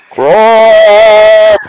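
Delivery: catchphrase shouted through a makeshift megaphone. Pretend. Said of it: "shout your catchphrase through a megaphone".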